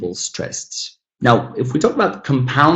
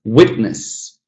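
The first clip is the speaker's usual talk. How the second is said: "Witness" as WIT-ness; Witness, front-stressed; first-syllable stress. Shown as a demonstration. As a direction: hit the opening syllable of Witness. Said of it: "stress on the first syllable".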